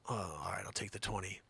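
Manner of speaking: low, soft giving-in voice; defeated seller. Acted. Broke seller gives in to twenty, defeated. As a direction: cave in, low and quiet.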